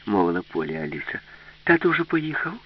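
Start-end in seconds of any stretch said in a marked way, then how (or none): 1.6-2.5 higher-pitched character question; lightly voiced Alice, pitch lifted. A narrator's reading. Alice asking the robot whether dad left, higher character voice.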